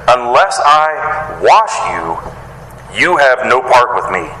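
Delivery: very slow, drawing out each word of Unless I wash you; slow, weighty emphasis. Shown as a demonstration. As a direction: linger on wash, let it sink in. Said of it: "did you catch those words of Jesus".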